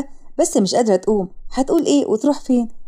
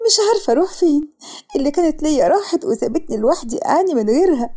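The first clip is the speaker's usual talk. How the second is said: tearful; 2 semitones higher than usual. Acted. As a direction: lament through acted tears. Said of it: "وسارة ببكاء". (بتعيط وهي بتشتكي)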